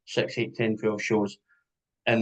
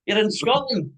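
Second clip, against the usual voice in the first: higher, louder exasperated rant; mock outrage; 6 semitones higher, 5 dB louder than usual. Scot ranting at a visitor over fruit pudding, mock outrage. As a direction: tear into him for insulting Scottish food. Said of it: "I nearly punched him in the face".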